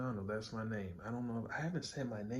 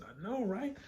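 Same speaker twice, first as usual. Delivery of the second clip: pitched way up, eager I know right; high excited agreement; 14 semitones higher than usual. Retold or imitated. Answering his wife about the comic, high and excited. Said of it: "I said".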